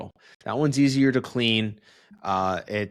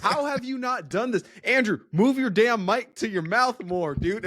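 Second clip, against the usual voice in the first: excited; 10 semitones higher than usual. Real, not acted. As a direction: push him excitedly to fix it. Excited demand to move mic.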